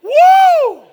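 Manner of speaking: laughing high whoop; joyful whoop. Real, not acted. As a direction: let out a big happy woo. Celebrates his win.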